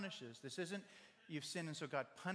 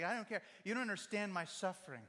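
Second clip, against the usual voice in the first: louder and higher, venting hurt fury; angry outburst; 5 semitones higher, 5 dB louder than usual. Retold or imitated. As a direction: snap it out like someone fed up with suffering. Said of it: "you get so mad".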